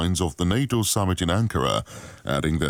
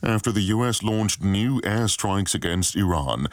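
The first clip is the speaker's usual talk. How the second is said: news read; 2 semitones higher than usual. Acted.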